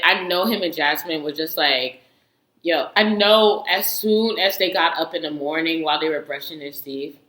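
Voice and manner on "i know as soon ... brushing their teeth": usual speaking voice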